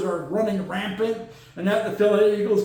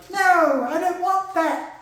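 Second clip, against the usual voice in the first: high, slow put-on voice saying no; high put-on refusal; 10 semitones higher, slower than usual. Brock Purdy refusing the offer, in a high mock voice. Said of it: "Brock Purdy was like".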